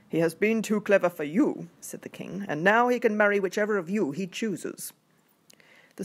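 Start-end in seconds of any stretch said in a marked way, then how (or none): 0.1-1.7 loud, commanding king; a booming royal voice. Acted. King repeats princess's words. 2.5-4.9 king voice. Acted. King announces to princesses.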